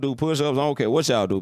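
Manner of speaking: ordinary delivery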